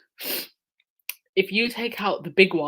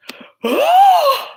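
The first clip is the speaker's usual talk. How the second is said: sharp, high gasp of shock; loud gasp; 11 dB louder than usual. Real, not acted. Shocked at a big donation.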